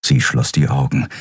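usual speaking voice

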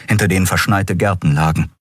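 ordinary delivery

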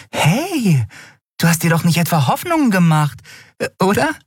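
high-pitched little friend, probing; concerned Nelen. Acted. Nelen asks Miko about his hopes, high and concerned. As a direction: ask in a high voice, hesitating before the end.

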